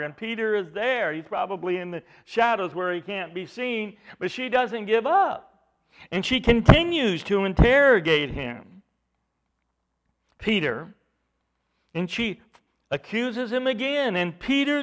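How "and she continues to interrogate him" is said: normal talking voice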